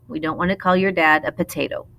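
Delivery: ordinary talk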